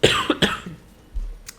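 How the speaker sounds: loud rough cough; harsh cough. Real, not acted. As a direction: cough hard off the mic. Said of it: "Oh, excuse me".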